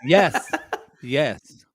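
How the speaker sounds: ordinary talk, nothing marked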